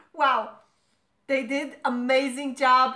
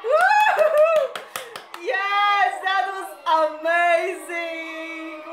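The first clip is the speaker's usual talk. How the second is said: ecstatic screams; 3 semitones higher, slower than usual. Real, not acted. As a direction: shout it out, overwhelmed with joy. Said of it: "I just cannot control those screams".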